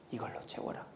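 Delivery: the world's sly whisper; hushed, voiceless temptation; tempting whisper. Acted. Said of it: "그게 누구의 속삭임입니까?".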